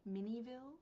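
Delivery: "Miniville" as MIN-ee-vil, questioning; softly tested "Miniville", said MIN-ee-vil; trying a pronunciation. Shown as a demonstration. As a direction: try "Miniville" with ee in the middle. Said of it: "how to pronounce this".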